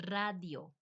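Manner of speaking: radio with rolled R and open A; Spanish radio. Shown as a demonstration. Giving the word in Spanish, radio as RRAH-dyo. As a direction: say radio as 'RRAH-dyo'. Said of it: "same exact word in Spanish".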